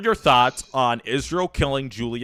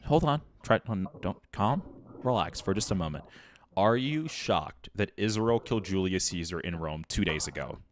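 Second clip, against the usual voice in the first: hushed calming; 6 semitones lower, 11 dB quieter than usual. Acted. Host soothing his heated guest, soft and hushed. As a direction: lower and soften, coaxing him to relax.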